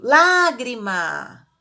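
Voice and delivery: loud, long open A in "lágrima"; slow, loud stress on the first syllable of "lágrima"; acute-accent vowel. Shown as a demonstration. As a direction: call out "lágrima", stretching the first A. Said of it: "Quando eu falo no acento agudo".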